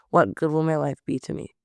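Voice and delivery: usual speaking voice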